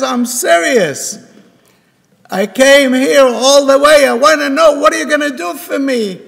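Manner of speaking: insistent father. Retold or imitated. Father insists to distressed son.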